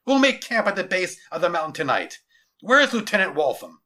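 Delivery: commanding general. Acted. General ordering camp and summoning his lieutenant, commanding. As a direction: issue orders to the whole company.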